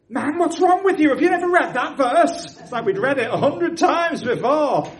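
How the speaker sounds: high, loud, laughing delight; laughing exclamation. Retold or imitated. Convert teasing the preacher through laughter, high and loud. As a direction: laugh it out in joyful disbelief.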